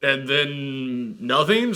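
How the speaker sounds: usual speaking voice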